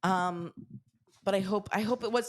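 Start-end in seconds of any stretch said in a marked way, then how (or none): none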